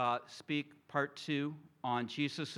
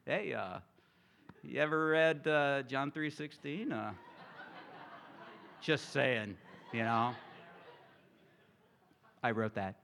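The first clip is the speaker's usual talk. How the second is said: mock proud John. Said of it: "It's like".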